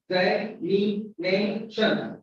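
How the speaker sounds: "Examination" slowly broken into five syllables; slow, separated syllables in "Examination". Shown as a demonstration. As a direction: give each syllable of "Examination" its own effort. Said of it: "We make five efforts in the pronunciation".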